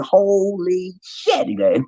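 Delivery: astonished. Retold or imitated. Stunned at overflowing fridge.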